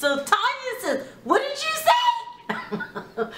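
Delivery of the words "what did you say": much higher, slow, laughing mock outrage; laughing at her own mock indignation; outraged disbelief. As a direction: squeal it out, half laughing, as the offended friend. Tanya offended by the insult.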